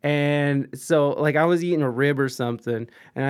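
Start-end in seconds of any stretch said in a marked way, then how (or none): none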